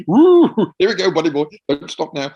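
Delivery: high, loud whoop then rallying; excited whoop. Retold or imitated. Performer urging himself on, loud excited whoop. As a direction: gee yourself up, buddy boy.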